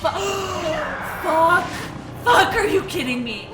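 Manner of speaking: loud startled cursing; shocked yell. Real, not acted. Shock at the spell.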